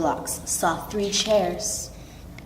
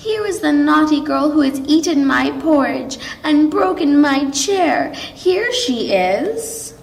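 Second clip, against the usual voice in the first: put-on higher, louder Baby Bear call, fast then slowing; tiny voice as loud as he could; 6 semitones higher, 4 dB louder than usual. A narrator's reading. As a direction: shout as big as a little bear can. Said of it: "making his tiny little voice as loud as he could".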